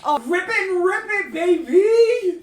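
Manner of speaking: rowdy cheer of encouragement; cheering shout. Real, not acted. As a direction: whoop it up through laughter. Cheering the performance.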